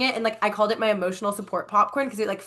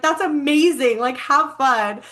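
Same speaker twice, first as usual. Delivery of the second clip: amused cheering; 5 semitones higher, 4 dB louder, slower than usual. Retold or imitated. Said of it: "they were, like, laughing so hard".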